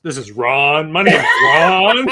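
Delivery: slow, loud goofy character voice, laughing; hammed-up dim guy introducing himself; Yak character impression. Friends riffing on a Yak show character, slow loud goofy voice. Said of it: "that one character that Yak had".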